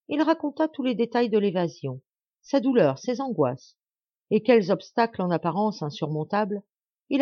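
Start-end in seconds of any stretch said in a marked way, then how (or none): none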